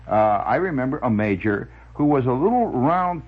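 ordinary delivery

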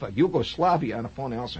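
ordinary delivery

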